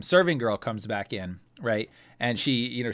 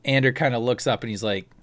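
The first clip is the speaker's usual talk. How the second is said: ordinary delivery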